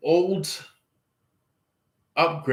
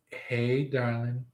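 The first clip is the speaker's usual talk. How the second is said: softer, tender hello; sweet greeting; 2 semitones lower, 9 dB quieter than usual. Pretend. Greeting your person at the gateway, soft and tender. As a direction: coo it gently to a lover.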